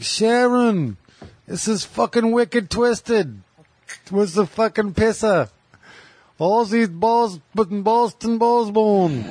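put-on slow, high rock-star wail with Boston accent. Riffing as [name] from Boston calling [name], mock Boston [name]. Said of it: "[name] from Boston".

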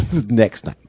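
normal talking voice

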